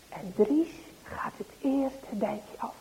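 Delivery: whispered, slow and secretive; hushed narration. A narrator's reading. Narrator whispering as the boys sneak to the cart. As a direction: tell it like a secret in the dark.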